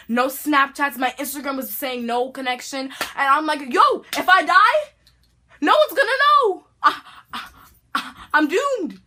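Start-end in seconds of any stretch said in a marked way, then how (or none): 3.7-9.0 high, loud, panicked wail; mock-terrified cry, much higher. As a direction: freak out that nobody will know. Panicked inner thought, no connection.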